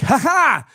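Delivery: loud, high "ha ha"; a pretend villain's gleeful laugh, pushed up; mock gloating laugh. Comic imitates her gleefully retweeting, loud mock laugh.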